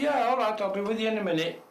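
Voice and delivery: loud, high, fast reply; imitated hurried shout; retold calling back. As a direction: holler back to put someone off. Calling back to impatient workmate.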